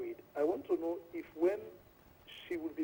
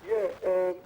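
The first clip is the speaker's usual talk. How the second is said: speaking up; 4 semitones higher, 8 dB louder than usual. Real, not acted. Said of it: "Speak up, please".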